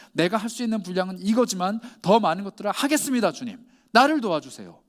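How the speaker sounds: loud, acted pledge and plea to the Lord; fervent plea. 삭개오처럼 주님께 외치는 고백. (주님께 크게 외치는 간절한 고백)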